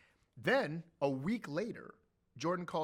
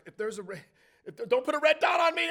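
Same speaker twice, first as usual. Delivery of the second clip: mock panic; 12 semitones higher, 13 dB louder than usual. Panicking while president hugs him.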